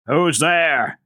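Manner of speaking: loud booming challenge; thundering shout. A narrator's reading. Doctor challenging an intruder in the hall, thundering. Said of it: "the doctor thundered forth".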